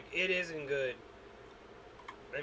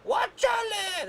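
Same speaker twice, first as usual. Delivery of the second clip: high, slow drawn-out yell; George Lopez shout; 4 dB louder, slower than usual. Pretend. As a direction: belt it like a comedian's catchphrase. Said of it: "Imagine that dog saying that".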